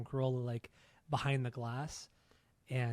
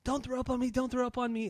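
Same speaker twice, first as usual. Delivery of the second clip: much higher, frantic mock plea; panicked girl; 11 semitones higher than usual. A girl begging a drunk singer, panicked. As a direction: squeal it like a girl about to get puked on. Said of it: "you hear this one girl saying".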